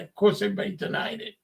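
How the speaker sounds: normal talking voice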